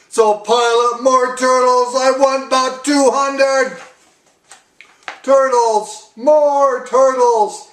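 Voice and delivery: high, loud bellowed orders; bellowing. A narrator's reading. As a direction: bray it at the whole pond. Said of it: "he bellowed and brayed".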